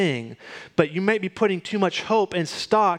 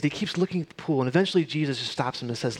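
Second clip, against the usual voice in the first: ordinary talk, nothing marked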